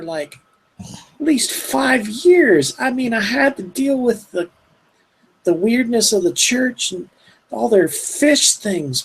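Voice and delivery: put-on high, slow, baffled old woman. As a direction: puzzle it out as Ma Tabby. GM voicing Ma Tabby.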